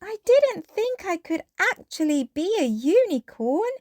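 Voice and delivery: put-on high little girl voice, amazed; Peppa's voice. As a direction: sound like a thrilled little piglet.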